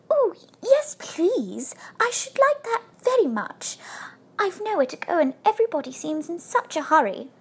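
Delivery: high, breathless little bear; excited. A narrator's reading. Bear accepting the invitation to stay, excited. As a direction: squeak out an eager acceptance. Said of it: "nearly fell off with excitement".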